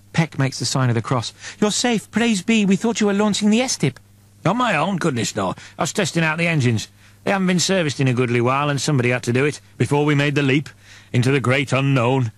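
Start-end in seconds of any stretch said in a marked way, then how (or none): 1.6-4.0 higher, breathless devout relief; gushing with holy relief; relieved pious woman. Acted. Peck greets Father Lewis relieved. 4.4-12.3 quick, oily reassurance; breezy denial, too smooth to trust; smooth innocent priest. Acted. Lewis explains innocently.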